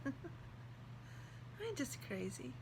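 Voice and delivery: tearful laugh, then a whisper; choked up, laughing it off softly; real tears, soft and shaky laugh. Real, not acted. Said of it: "I'm sorry for crying".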